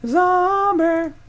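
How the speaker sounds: very high, loud, drawn-out cry; goofy stretched-out exclamation; playful zombie call. Pretend. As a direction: belt out Zombie in a silly high voice. Playful calling out of a card.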